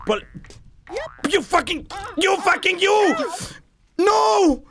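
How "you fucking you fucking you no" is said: furious shout. Real, not acted. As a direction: yell at the game in rage. Falling in game.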